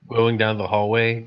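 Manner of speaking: normal talking voice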